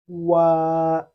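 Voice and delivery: loud, held wa for students to copy; Chinese wa. Shown as a demonstration. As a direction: model wa clearly. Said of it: "Repeat after me".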